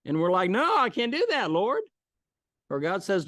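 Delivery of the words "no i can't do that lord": put-on much higher, childish no; a mock-whiny believer; whiny refusal. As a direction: whine back at God. We refuse God.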